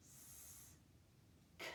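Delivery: bare hissed S, then a clicked K without a vowel; sounded out. Shown as a demonstration. Teacher isolating consonant sounds, sounded out. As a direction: say S and K as sounds, not letter names. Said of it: "four sounds".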